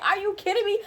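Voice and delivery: high-pitched retold disbelief. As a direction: squeak it out in disbelief. Retold disbelief at labor news.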